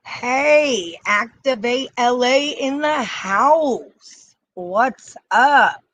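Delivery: loud, high, drawn-out hype call; hyped greeting. Real, not acted. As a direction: pump up the stream crowd. Hyped greeting opening the live stream.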